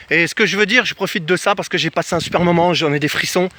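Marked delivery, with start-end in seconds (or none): none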